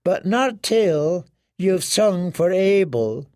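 high, slow, soothing woman's voice promising death; comforting him towards his death; sweetly menacing washerwoman. Acted.

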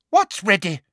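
high, loud demand; teacher demanding an answer. Acted. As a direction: insist on an answer. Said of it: "demanded Umbridge".

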